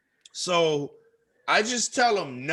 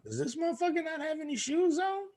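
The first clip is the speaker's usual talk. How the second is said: inner thought voice; 12 semitones higher, 7 dB quieter, faster than usual. Retold or imitated. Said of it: "I was thinking".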